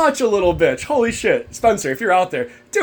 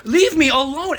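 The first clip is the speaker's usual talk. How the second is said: retold plea; 7 semitones higher than usual. Own plea before it is mocked.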